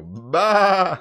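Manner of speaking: loud, high "bah"; sudden comic bleat of a shout; imitated scream. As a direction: mimic your friend's random yell. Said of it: "randomly hear you go".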